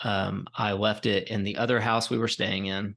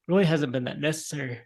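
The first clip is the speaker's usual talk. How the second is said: ordinary talk, nothing marked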